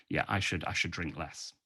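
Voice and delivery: soft, low resolve trailing away; subdued contrite drinker. Acted. British drinker thinking over a warning billboard, soft and contrite. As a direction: murmur it low, as a private thought. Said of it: "British people would look at that and think".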